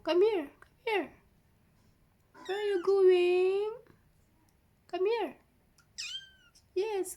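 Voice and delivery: talking to a kitten. Real, not acted. Calling a kitten over.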